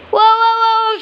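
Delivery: high, loud startled cry; startled whoa. Pretend. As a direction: yelp as it tumbles down. Toy bird crash landing.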